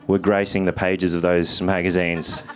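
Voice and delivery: ordinary talk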